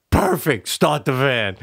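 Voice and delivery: mock creep. Mock kidnapper after children answer.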